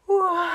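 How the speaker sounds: loud high yawn; big yawn. A narrator's reading. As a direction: stretch out a sleepy yawn. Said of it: "gähnte der kleine Tannenbaum laut".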